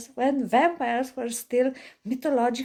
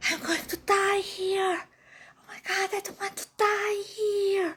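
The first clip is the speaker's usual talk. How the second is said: scared whimper; 7 semitones higher than usual. Retold or imitated. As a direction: play the frightened friend. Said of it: "And she was like".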